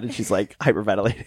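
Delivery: ordinary delivery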